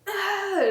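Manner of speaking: a sharp high strained cry; quick pained push sound; mock push groan. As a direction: act out bearing down. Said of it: "take ... a deep breath to get ready to".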